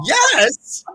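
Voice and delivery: high, loud, drawn-out squeal of a yes; excited disbelief. Retold or imitated. Reader reliving the pendulum's answer, high astonished squeal. Retelling her startled pendulum reaction.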